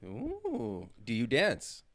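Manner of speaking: ordinary delivery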